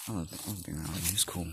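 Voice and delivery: ordinary talk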